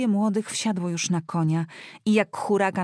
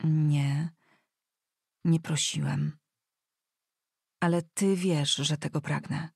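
subdued, halting admission; hesitant answer; 3 semitones lower than usual. A narrator's reading. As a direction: answer reluctantly, with long pauses. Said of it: "Teraz on zwlekał z odpowiedzią".

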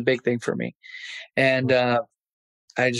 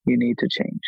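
inner voice whisper. Retold or imitated. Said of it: "a voice said".

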